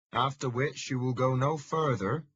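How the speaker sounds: put-on a Fenland farmer's broad local accent, dry and wry; familiar local accent. Roadside man giving directions to Thomas in a local accent. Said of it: "he says in a familiar accent".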